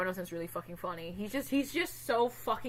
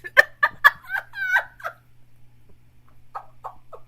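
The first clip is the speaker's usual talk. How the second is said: shrill burst of laughter; high laughing fit; 8 dB louder than usual. Real, not acted. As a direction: lose it completely. Laughing at the story.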